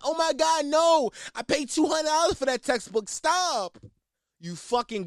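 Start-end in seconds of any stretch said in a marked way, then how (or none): 0.0-3.8 high, whiny mock panic; whiny college kid. College kid whining about his two hundred dollar textbook, high mock panic. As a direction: play a college nerd upset about a lost textbook. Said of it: "You're like".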